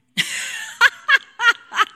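loud, high, delighted laugh; high burst of laughter. Real, not acted. Laughing at woman's quote.

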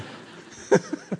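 high, breathy laugh. Real, not acted. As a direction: laugh out at the memory. Laughing at the bystander.

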